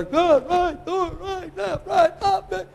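high, strained corner-man calls; boxing trainer. Acted. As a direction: bark out punches like a boxing coach. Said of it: "the trainer's over there".

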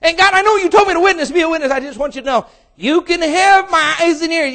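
loud, high, cheeky bargaining; bargaining youth. Acted. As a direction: play the selfish young person.

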